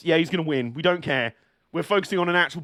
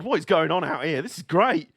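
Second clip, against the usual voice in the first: ordinary talk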